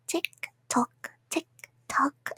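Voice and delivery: soft taunting whisper, drawn out; whispered tick tock. Acted. Captor whispers a ticking threat to captive. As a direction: breathe the clock ticking at them.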